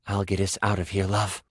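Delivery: low-pitched reassuring lover; partner's tender voice. Acted. Partner carries their exhausted love away, low reassurance. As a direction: drop low to comfort your love.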